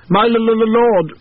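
stuttering, high and loud; stammering. A narrator's reading. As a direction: stumble over the first sound. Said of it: "Percival Allard stammered".

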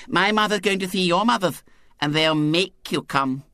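put-on high, lisping, confident child; serene little girl. A narrator's reading. Girl telling boys their mothers decide, serene. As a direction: explain serenely as a small girl who will win. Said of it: "said Violet Elizabeth serenely".

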